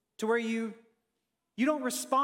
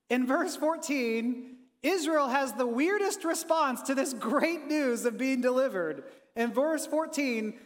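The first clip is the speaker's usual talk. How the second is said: ordinary delivery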